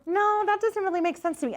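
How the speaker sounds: high-pitched, tentative client voice; imitated unsure client. Medium acting out a client's reply, high and unsure. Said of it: "And if you say".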